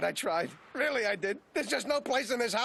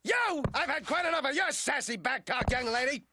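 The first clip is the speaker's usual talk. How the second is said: high, irate parental yelling; angry scolding; 3 semitones higher than usual. Acted. Father fed up with daughter's back talk, angry. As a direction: yell after a runaway child.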